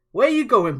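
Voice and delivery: put-on loud, high yell after the boy; angry shout. Commander yells at the boy running away, loud shout. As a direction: shout him down as he runs.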